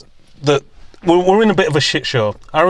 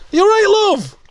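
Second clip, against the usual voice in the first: much higher and louder call; mock distant shout; 4 dB louder than usual. Imitating someone calling to a granny while keeping distance. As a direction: yell it from way across the street. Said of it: "shouting to her from across the road".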